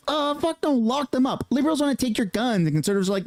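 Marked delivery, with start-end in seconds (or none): 0.1-1.5 higher, mock-confused conservative voice; imitating a conservative in a raised mocking voice. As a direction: play a baffled conservative, pitched up.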